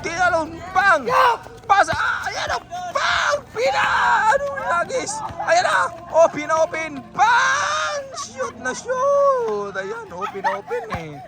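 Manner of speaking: shouted match commentary with punched calls; excited play-by-play. Real, not acted. Commentator following passes to a shot, shouting excitedly.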